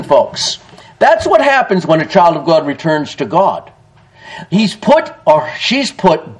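ordinary talk, nothing marked

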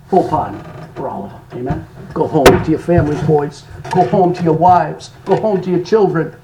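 low, soft, fatherly release; hushed, tender storyteller's rendering of a president; merciful pardon. A narrator's reading. Voices merciful president pardoning captured soldiers.

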